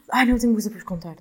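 loud burst of remembering; sudden excitement. Real, not acted. Vlogger suddenly remembers news for her viewers, excited burst.

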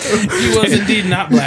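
slow, laughing through words; chuckling deadpan. Real, not acted. Joking, laughing.

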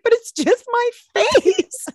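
high, drawn-out mock wail; protesting. Sister defending her own face, high mock protest.

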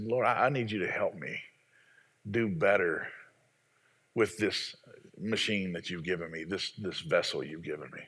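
low, hushed plea to God; humble prayer. Retold or imitated.

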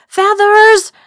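high, loud desperate call; scream. Acted. Narrator screaming to Feathers for help, loud high call. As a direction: scream for help across the hall. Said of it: "I screamed".

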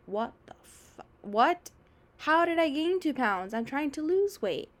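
shocked disbelief. Pretend. Dieter reacting to a heavier scale, mock shocked disbelief. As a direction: act out the shock of gaining two pounds. Imagined dieter seeing weight gain.

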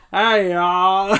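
drawn-out cartoon Southern drawl; hammed-up blustery rooster greeting; Foghorn Leghorn. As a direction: imitate a Southern cartoon holler. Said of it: "it's just like Foghorn Leghorn".

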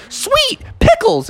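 very high happy squeak; excited kid bear. Acted. Junior Bear finding spilled pickles, high and gleeful. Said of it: "Junior Bear was like".

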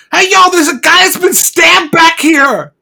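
high, loud, drawn-out yell; shouted alarm. Acted. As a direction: call out the news to a whole crowd. Bystander raises alarm in story.

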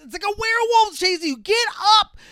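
high, loud yell at the screen; frantic shout. Real, not acted. Yelling at the victim on screen, loud frantic shouting. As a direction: scream at him to get up.

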